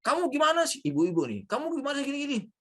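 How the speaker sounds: teacher imitating an angry boss; scolding boss. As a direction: snap at the staff like an irritated boss. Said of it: "pimpinan kalian yang marah-marah".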